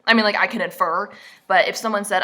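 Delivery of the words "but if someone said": ordinary talk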